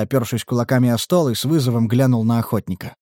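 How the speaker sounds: plain reading voice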